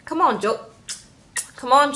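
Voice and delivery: ordinary delivery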